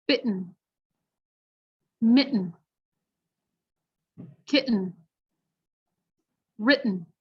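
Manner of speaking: each word with the T stopped in the throat before a nasal N; slow list of American glottal T words; glottal T drill. Shown as a demonstration. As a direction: swallow every T. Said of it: "repeat after me".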